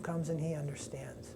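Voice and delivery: whispered, soft narration; a sacred hush over the fainting. A narrator's reading. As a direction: breathe it like a secret. Hushed moment in story.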